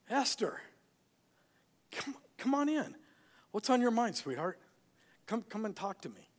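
lightly voiced doting king calling his sweetheart; gentle king. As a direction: coax her in tenderly. King gently invites queen in.

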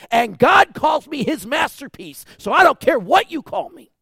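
high, loud climactic preaching; full-force preaching. Acted. The pastor rallies the congregation to guard their crowns. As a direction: build the sermon to a rousing peak.

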